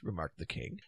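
ordinary delivery, nothing marked

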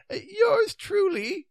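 high, slow, wavering knight; feeble. A narrator's reading. Stranded knight refusing to court the beast, feebly. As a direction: falter in a thin high voice. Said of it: "said Sir Palomides feebly".

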